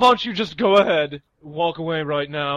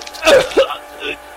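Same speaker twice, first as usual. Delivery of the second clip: strangled scream; 10 semitones higher, 7 dB louder than usual. Acted. Scream-laugh while strangled.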